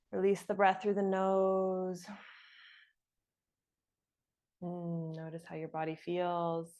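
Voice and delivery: soft, low settling voice; guided meditation. Real, not acted. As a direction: ease them gently out of the exercise. Closing breath meditation.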